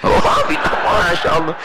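half-joking scandalised wife; mock gasp of disapproval. Wife reacts to husband's kneeling.

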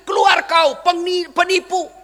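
loud, high acted yell; hostile jeer. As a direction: drive the intruder out, denouncing. Crowd drives out the Prophet.

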